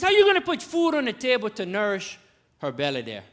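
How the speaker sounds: high, loud mock interrogation; imitated father-in-law, loud and high; demanding father-in-law. Father-in-law grills suitor.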